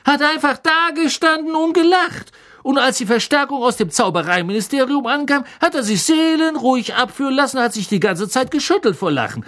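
high, loud breathless storyteller; excited gossip. Acted. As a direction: relish every grisly detail. Stan voiced by narrator.

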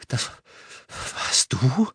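weak, shivering voice; teeth chattering. A narrator's reading. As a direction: ask through chattering teeth. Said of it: "mit klappernden Zähnen, die Stimme schwächer". (zähneklappernd und schwach)

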